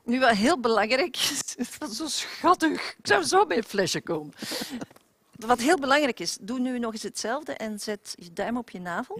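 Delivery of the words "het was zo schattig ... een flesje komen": doting. Real, not acted. Cooing at baby sound.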